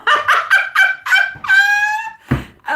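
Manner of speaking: high, loud burst of real laughter; helpless cackling laugh, way up high. Real, not acted. Tarot reader cracking up at a viewer's question, loud high laughter.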